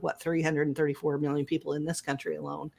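usual speaking voice

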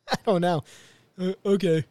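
mock dull mumbled okay; sloth-like son. Podcaster mimics the son's flat reply, lightly done. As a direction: play a bored slacker kid. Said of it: "makes him sound like a sloth".

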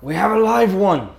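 high, loud call-out; crew member's yell. Acted. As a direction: holler the news across the deck. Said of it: "One of the crew members yells out".

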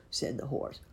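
plain reading voice

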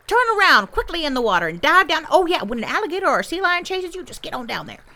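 put-on fast, high, loud old beaver instructor; grandpa beaver, urgent coaching. Grandpa beaver showing young beavers how to dive, urgent coaching. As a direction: rattle out the diving orders excitedly.

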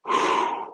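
long blown-out breath, acted; steadying exhale. Starstruck wrestler composing himself, heavy exhale. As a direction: blow out the nerves.

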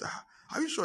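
high, soft disbelief; incredulous. Acted. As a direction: ask it up high, not believing him. Friend doubts the claim.

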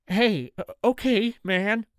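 high, nervous stammer; shaky voice. A narrator's reading. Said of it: "his voice shaky".

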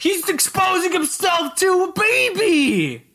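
high, indignant outburst; outraged cry. Real, not acted. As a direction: cry out in mock horror. Outraged high-pitched outcry.

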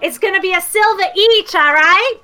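put-on high, sassy street kid; cheeky kid. Rickshaw kid sasses the customer in a high kid voice. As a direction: talk back like a cocky urchin.

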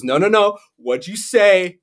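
put-on pushing the kid to repeat the word; dad goading his kid; mock dad. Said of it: "He goes".

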